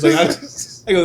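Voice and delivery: ordinary talk, nothing marked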